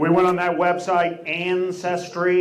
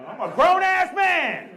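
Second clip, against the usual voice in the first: high angry yell of a grown man; indignant shout; 12 semitones higher than usual. Retold or imitated. Buddy yelling at whoever tells him to buckle up, indignant shout. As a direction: bellow it, offended at the seatbelt. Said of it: "He doesn't like that".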